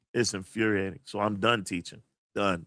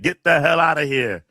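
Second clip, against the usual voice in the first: higher, louder mock yell; loud quote; 4 semitones higher, 7 dB louder than usual. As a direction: throw out a loud quoted outburst. Acted movie-style quote.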